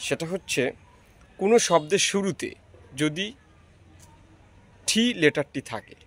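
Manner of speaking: usual speaking voice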